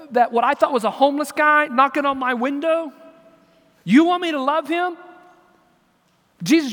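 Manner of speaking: loud, high-pitched incredulous pleading; protesting to God. Acted. Acts out wrestling with Jesus.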